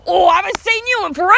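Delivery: loud, high imitation of a delighted customer; hammed-up sudden friendliness; mock hearty greeting. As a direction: hail a long-lost mate. Customer greets acquaintance, acted out.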